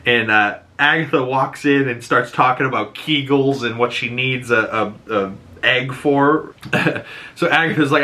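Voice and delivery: ordinary talk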